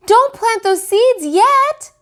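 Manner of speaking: put-on very high, loud, slow child's yell; shouted warning. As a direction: yell at the others to stop. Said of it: "shouted Flossie".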